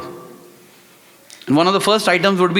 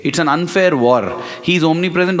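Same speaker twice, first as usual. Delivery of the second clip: ordinary talk, nothing marked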